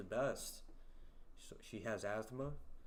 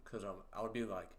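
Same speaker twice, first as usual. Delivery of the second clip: ordinary talk, nothing marked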